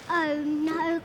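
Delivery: usual speaking voice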